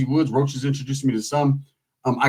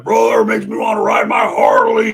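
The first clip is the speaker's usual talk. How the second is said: pumped-up biker shout; 6 semitones higher, 5 dB louder than usual. Retold or imitated. Bikers voiced enthusiastically.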